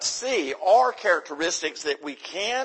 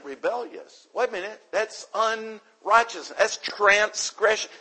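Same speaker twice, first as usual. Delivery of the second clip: ordinary talk, nothing marked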